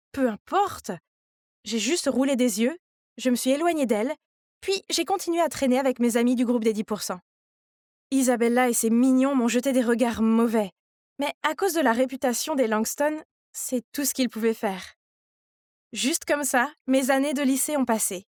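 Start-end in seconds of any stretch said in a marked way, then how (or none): none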